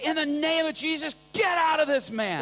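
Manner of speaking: commanding cry. Retold or imitated. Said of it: "And he's like".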